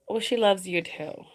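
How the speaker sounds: ordinary delivery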